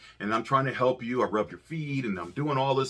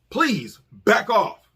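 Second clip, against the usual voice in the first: very slow, louder, deliberate; firm warning; 7 semitones higher, 5 dB louder, much slower than usual. Retold or imitated. Husband firmly telling the nurse to back off. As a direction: spell it out firmly.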